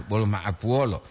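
usual speaking voice

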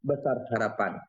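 unhurried Malay poetic delivery; pantun recitation. Shown as a demonstration. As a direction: recite the verse with feeling, pausing between phrases. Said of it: "itu kan contoh kita bawakanlah pantun".

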